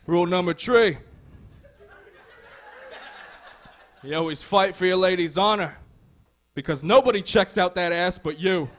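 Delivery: a Brooklyn king barking out his rules; Brooklyn accent. Retold or imitated. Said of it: "why he's from Brooklyn".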